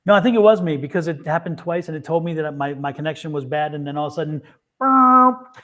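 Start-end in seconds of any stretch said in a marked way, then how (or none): 4.8-5.6 loud, higher blurted "burp" noise; imitated dropout noise. Host mimicking the connection suddenly dying, comic noise. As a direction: blurt out a comic cut-off sound.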